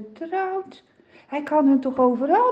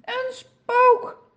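trembling with fear; 7 semitones higher than usual. A narrator's reading. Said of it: "Ze trillen van angst".

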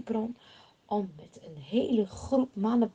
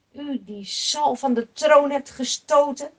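cursing; 5 semitones higher, 10 dB louder than usual. Acted. Shimei cursing the fleeing King David, loud and jeering. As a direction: spit out a mocking insult. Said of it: "hij roept ... scheldt hem uit".

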